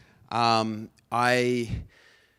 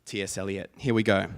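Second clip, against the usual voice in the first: ordinary talk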